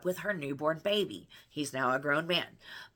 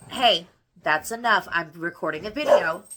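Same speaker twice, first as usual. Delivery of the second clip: firm scolding; 10 dB louder than usual. Real, not acted. Scolding her kids mid-recording.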